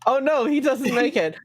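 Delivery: amused high exclaim. Real, not acted. GM reacts, high.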